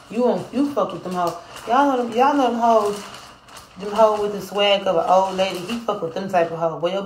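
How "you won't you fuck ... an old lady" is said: higher, loud teasing call to the chat; loud mocking. Real, not acted. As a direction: hype up the insult to the crowd. Roasting chatter.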